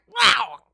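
loud cartoonish wow; generic animal wow. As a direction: belt out a goofy animal wow. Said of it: "a generic animal sound".

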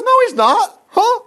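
very high-pitched, hammed-up protest; disbelieving denial. Jonathan denying his father wants David dead, hammed-up disbelief. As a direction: squeak out an incredulous denial.

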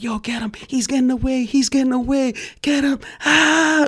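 high, shouting mock chase cries; retold gleeful cop hollering; imitated cops screaming. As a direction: yell it like cops hanging out of a car window. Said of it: "Like, screaming".

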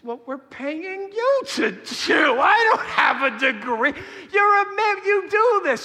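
high, loud, half-crying protest; panicked shout. Retold or imitated. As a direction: yell it at the midwife in comic terror. Dad refuses to catch baby.

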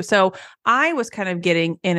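usual speaking voice

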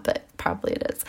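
whispered dread, barely voiced; whispering a worried thought to herself, quickly. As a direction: breathe the words under the voice, quick. Private worried thought, quoted.

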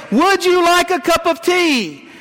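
right behind her chair, he asks loudly and slowly. Retold or imitated. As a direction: call the question out slowly so she can't miss it. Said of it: "in a loud voice".

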